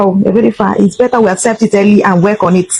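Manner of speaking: ordinary delivery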